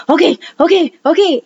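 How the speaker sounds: high, flustered mock okays; lightly hammed-up nervous okays; imitating panicked partner. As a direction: mimic a rattled husband saying okay over and over. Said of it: "And he was freaking out".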